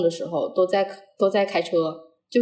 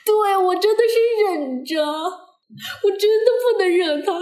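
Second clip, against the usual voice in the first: high, long-drawn mock-suffering whine; plaintive wail; 11 semitones higher, much slower than usual. Real, not acted. Student tells a friend she endured it all, in a plaintive wail. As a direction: stretch it out in a comically pained high voice. Comic lament about enduring the instructor.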